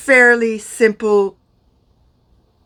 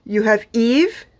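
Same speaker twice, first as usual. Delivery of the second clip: usual speaking voice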